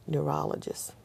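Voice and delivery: usual speaking voice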